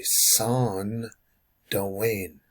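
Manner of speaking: "Son" held, a pause, then "Dwayne"; syllable break. Shown as a demonstration. Teacher showing syllables, "Son" and "Dwayne" set apart. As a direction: break the word after "Son". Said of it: "The syllable is gonna break here".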